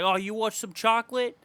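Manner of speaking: put-on a touch of little-kid voice; lightly voiced little kid. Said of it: "like a little kid".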